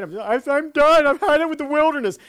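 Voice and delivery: high, loud exasperated deer voice; hammed-up despairing animal; fed-up deer. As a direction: play a deer that's done with life.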